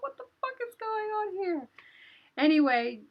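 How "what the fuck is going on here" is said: retold panic in a very high voice; bewildered alarm. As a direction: squeal the baffled question, reliving it. Bewildered amid villagers' panic, retold.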